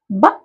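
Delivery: ब as a very short, clipped 'buh'; letter sound. Shown as a demonstration. Teacher gives pupils the sound of B, clipped ब. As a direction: cut ब off at once. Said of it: "B का उच्चारण बोलेंगे".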